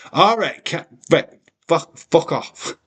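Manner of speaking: loud, high fuck off; hammed-up fed-up yell; mock rage. Chat teasing, mock anger.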